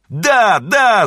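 high, loud, drawn-out yell of agreement; whole class shouting. A narrator's reading. Pupils agreeing to learn German, loud high shout. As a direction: shout it eagerly like a classful of children. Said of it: "закричал весь класс".

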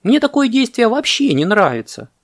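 very high, indignant monkey complaint; shouted protest. A narrator's reading. Monkey protests from the palm, shouting. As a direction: cry out in stubborn protest. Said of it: "крикнула с пальмы мартышка".

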